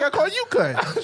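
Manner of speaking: high, slow, laughing through it; laughing innocent reply. Retold or imitated. Retelling clueless reply to friend, laughing high. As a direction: giggle it out, clueless. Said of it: "I was like".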